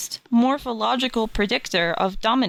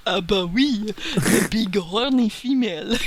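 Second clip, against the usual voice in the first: lightly put-on French accent; mock French researcher. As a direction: play a pretend French scientist with an accent. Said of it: "is probably gonna come on here and be like".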